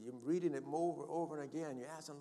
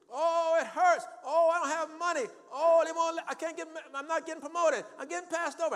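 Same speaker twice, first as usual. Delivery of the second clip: loud, high-pitched mock wailing; exaggerated moaner at full volume; mock complaining; 14 dB louder than usual. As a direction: wail out a hammed-up list of woes. Voicing a complainer who believes circumstances.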